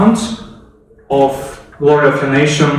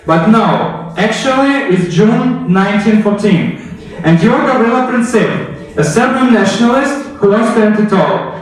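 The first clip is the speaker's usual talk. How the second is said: raised, urgent history narration; dramatic storytelling; 4 semitones higher than usual. A narrator's reading. History teacher pulling viewers into the assassination, raised dramatic narration. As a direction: build the reveal like a storyteller.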